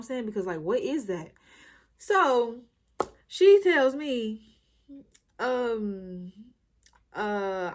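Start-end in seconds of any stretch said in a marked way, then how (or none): none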